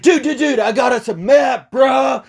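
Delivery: high, excited bro shout; ecstatic. Retold or imitated. Guy runs back to friends with a map, ecstatic. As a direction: burst out with mock elation. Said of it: "he was so ecstatic".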